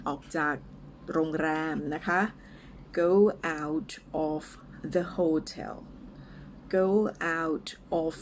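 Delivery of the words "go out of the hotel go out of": word-by-word model for repeating, with pauses; slow and clear. Shown as a demonstration. English teacher models a phrase for learners, slow and clear. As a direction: say it slowly, each word apart. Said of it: "ช้าๆ ชัดๆ ไปก่อนนะคะ".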